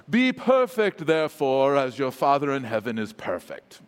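Jesus with a put-on British accent; measured, formal British English recitation. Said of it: "picture Jesus talking in a British accent".

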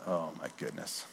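very soft, low murmur; quiet, regretful thought to oneself; hushed remorse. Retold or imitated. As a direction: let it sink almost to nothing. Recalling remorse at wife's tears.

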